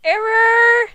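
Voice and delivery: high, loud call across the field; shouting a name. Acted. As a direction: yell his name to get his attention. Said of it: "Shouted Ink".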